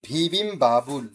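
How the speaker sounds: "ভি বিম বাবুল" slowly, in two separate parts; pronunciation demo. Shown as a demonstration. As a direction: model "ভি বিম বাবুল" with long, measured syllables. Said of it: "এটা উচ্চারণটা হবে এরকম".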